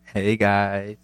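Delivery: smooth, put-on sexy greeting; sexy YouTube voice. Guest saying hello in his YouTube voice, sexy. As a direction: greet the viewers in your sexy channel voice. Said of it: "[name] dropped the sexy".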